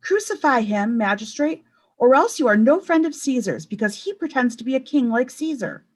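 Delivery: high, loud threat; shouting. A narrator's reading. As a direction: shout him down. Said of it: "Caiaphas too was shouting".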